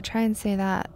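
usual speaking voice

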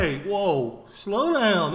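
loud, startled call to halt the video; calling out to stop. Real, not acted. Reacting to a fast explainer video, a loud call to slow down. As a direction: throw a loud hand up: hold on, stop. Stopping the clip, overwhelmed.

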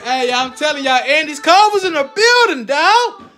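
loud, high hype-man shout. Real, not acted. Dad hypes the viewers with a loud, high shout-out.

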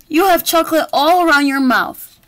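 loud high accusation; accusing shout. Acted. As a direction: call out the culprit triumphantly. Detective accuses bear loudly.

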